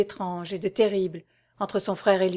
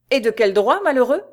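louder and higher, demanding; angry challenge; 4 semitones higher, 5 dB louder than usual. Acted. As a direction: hurl the question at your brother. Brothers quarrel over Blanche.

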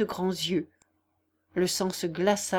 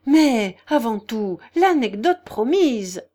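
heated insistence; 3 semitones higher, 7 dB louder, slower than usual. A narrator's reading. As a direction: object with fervour, stretching the last words. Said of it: "fit avec chaleur Ivan Fedorovitch".

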